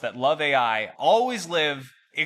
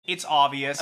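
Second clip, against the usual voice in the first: ordinary talk, nothing marked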